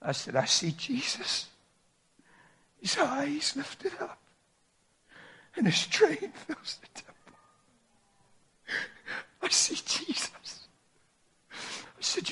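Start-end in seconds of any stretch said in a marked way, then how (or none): 0.3-10.7 high, slow, breathy wonder; awed hush. Retold or imitated. Father awed before his son, slow and hushed. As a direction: marvel at the vision in a hush.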